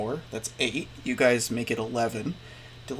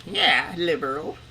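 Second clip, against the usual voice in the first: lightly imitated meme villain, drawn out; slow, slightly raised mocking jab; Joker; 4 semitones higher, slower than usual. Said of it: "The, the Joker with the memes".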